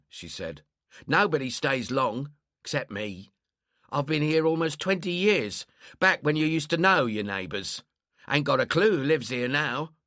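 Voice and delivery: lightly voiced lonely neighbour; grumbling old London woman. Old woman talks about neighbours.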